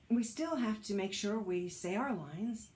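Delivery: ordinary delivery, nothing marked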